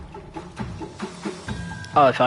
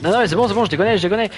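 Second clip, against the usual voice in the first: flustered; 7 semitones higher, 6 dB louder, faster than usual. Real, not acted. Panicked joking denial to enemies.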